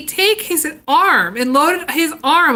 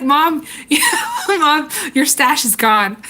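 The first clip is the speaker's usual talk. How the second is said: giggling through the words; laughing tease; 2 semitones higher, slower than usual. Real, not acted. Kids laughing as they tell mom her chocolate is gone.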